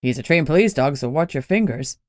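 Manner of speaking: low, dry caution; mild warning from the cop. Acted. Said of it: "Gunnar warned".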